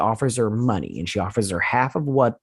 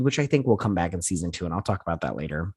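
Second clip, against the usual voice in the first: normal talking voice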